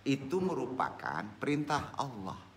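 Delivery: normal talking voice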